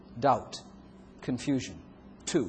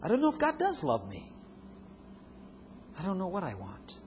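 a doubting believer pleads, pitched up; 2 semitones higher, 5 dB quieter than usual. Acted.

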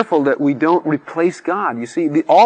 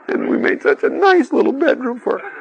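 laughing; 11 semitones higher than usual. Real, not acted. Laughing about daughter leaving home.

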